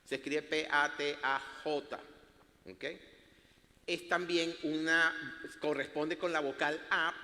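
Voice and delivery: ordinary talk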